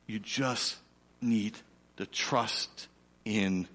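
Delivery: lightly voiced, soft, tender reassurance; Jesus speaking gently. Jesus reassuring the striving believer, soft and tender. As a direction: speak softly like a loving saviour, hushed. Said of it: "And Jesus says to us".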